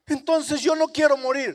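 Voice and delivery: high, loud, hurt plea; loud, wounded cry to the Lord; pleading sinner. Acted. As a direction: accuse the Lord of not loving you.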